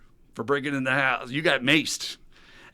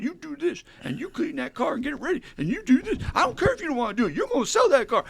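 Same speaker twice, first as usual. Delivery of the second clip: high-pitched mock bossing around; bossy boss; 14 semitones higher, 4 dB louder than usual. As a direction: rattle off orders like an overbearing boss. Acting out Jim as bossy boss.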